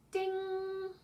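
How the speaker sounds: high sung "ding" bell. Shown as a demonstration. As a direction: chime it like a bell. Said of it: "ding if it rhymes".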